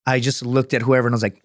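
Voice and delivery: ordinary talk, nothing marked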